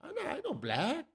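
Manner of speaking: high, soft, breathy-pitched Anderson Silva imitation in a [name] accent; mock the fighter's [name] accent, way up high and hushed. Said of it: "with his weird, eh, fucking [name] accent".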